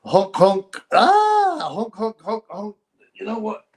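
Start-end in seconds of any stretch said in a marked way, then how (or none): 0.0-0.8 honking like a clown horn; silly clown horn honks. Pretend. Clown honk mockery. 0.9-1.7 sudden high-pitched squeal. Pretend. Squeal before honks. 1.7-2.8 quieter high clown honks; silly clown. Pretend. Clown honk mockery.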